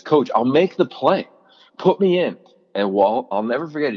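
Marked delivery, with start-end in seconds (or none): 0.0-2.4 higher, pleading and insistent, pushing harder; insistent plea. Retold or imitated. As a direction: beg the coach to put you in. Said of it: "he goes".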